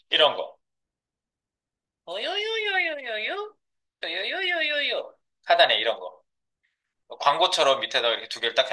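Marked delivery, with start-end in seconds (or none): 2.1-5.1 high sing-song. Acted. Pointing out the ad area.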